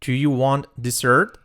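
usual speaking voice

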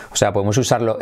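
ordinary talk, nothing marked

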